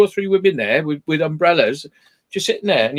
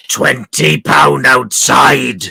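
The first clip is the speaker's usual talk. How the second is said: slow, louder, drawn-out croak of an offer; rasping street woman; 6 dB louder, much slower than usual. Retold or imitated. Mocking the prostitute at the car window, slow louder croak. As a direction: drag the words out in a wrecked old voice. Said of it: "with a voice like that, it sounds like you've got cancer of the fucking throat".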